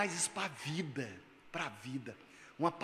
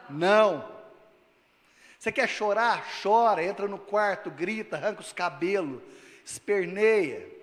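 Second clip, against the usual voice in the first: louder exhortation, pounding each command; forceful preaching; 3 semitones higher, 6 dB louder than usual. Acted. Pastor urging the congregation to weep then rise, loud preaching. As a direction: drive the words home to the church.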